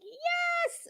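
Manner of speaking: excited yes. Retold or imitated. Retelling the bed delivery call, a thrilled high yelp.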